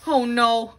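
usual speaking voice

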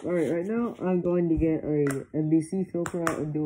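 speaking inside full face mask; muffled through gas mask. Real, not acted. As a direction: voice through mask without filter. Seen 5: mask lens and straps on face while speaking, partly off-frame.

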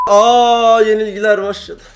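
high and loud dismayed yelp; shocked cry. Real, not acted. Gamer reacting to viewers as losses start, shocked cry. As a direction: gasp as the defeats begin. Sudden losing streak.

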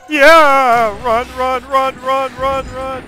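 high loud panicked screaming; being chased. Real, not acted. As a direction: scream while fleeing for your life.

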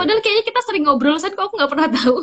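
high, loud disbelief tipping into laughter; baffled amazement. Real, not acted. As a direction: protest in amused astonishment. Still baffled she never knew.

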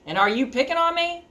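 high, rising challenge; demanding middle goat. Acted. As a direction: press the bully for an answer. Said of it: "demanded Middle Billy Goat Gruff".

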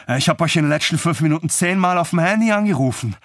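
high, hectic calling out; agitated exclamation. A narrator's reading. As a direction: burst out with an exasperated complaint. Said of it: "rief Didi". (aufgeregt gerufen)

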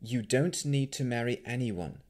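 mildly confident reassurance; a touch of firm confidence. A narrator's reading. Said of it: "Navarra said confidently".

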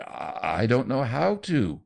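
slow, hesitant, scared reply; faltering. A narrator's reading. Nephew admitting to his aunt he can't, slow and faltering. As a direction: stumble through it helplessly. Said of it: "faltered Leonard".

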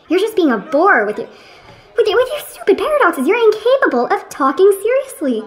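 high-pitched, indignant telling-off; exasperated scolding. Acted. Friend scolding his companion over silly paradoxes, high and exasperated.

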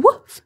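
a high yappy bark; high loud woof. Pretend. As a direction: bark it like a small dog. Dog sound imitated.

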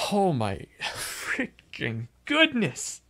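slow, drawn-out exasperation with a laugh; exasperated disbelief. Real, not acted. Player relieved the chase segment is over, exasperated. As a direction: drag it out, half laughing in disbelief. Venting after hard segment.

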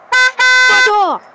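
loud, high yell at them; sudden furious outburst; angry shout. Real, not acted. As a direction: bark it out to drive them off. Yelling at game characters.

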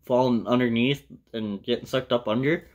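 ordinary delivery

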